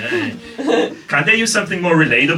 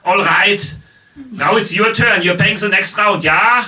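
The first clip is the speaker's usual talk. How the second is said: put-on loud, higher German-accented demand; heavy German accent; 6 semitones higher, 4 dB louder, slower than usual. German telling his date to pay the next round, German-accented insistence. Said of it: "She just wants German sounds, not German logic".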